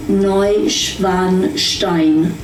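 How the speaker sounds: Neuschwanstein said slowly as NOY-shvahn-shtine; carefully voiced German Neuschwanstein, shv sound; German pronunciation. Shown as a demonstration. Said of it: "In German, you say".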